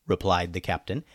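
usual speaking voice

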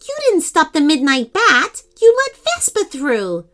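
high, indignant outburst; indignant accusation. A narrator's reading. Pearl accuses the cheating octopus.

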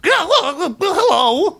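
high nervous little gnome; squeaky flustered inventor. Acted.

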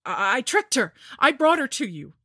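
high, loud stammering plea; loud, fearful stammer; frightened pleading. A narrator's reading. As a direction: beg in a panic. Dudley pleading to the Lich.

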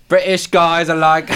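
loud, high, drawn-out British accent; joke British accent. Shown as a demonstration. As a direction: exaggerate a mock British accent for laughs. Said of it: "debating if I wanna do the accent".

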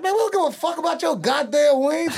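high hammed-up brag about the wings; high mock Faison brag. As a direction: ham up Faison waving off the wings. Faison quoted, mock boastful.

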